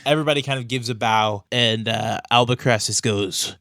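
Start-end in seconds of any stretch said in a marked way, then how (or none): none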